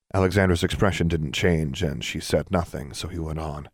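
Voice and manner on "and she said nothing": plain reading voice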